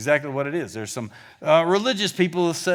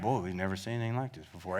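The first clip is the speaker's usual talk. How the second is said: mumbled fast and low, no spunk at all; flat, unimpressed; 10 semitones lower, 14 dB quieter, much faster than usual. Shown as a demonstration. Preacher showing how the crowd did not say it. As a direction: throw it away as if bored. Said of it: "It's not that at all".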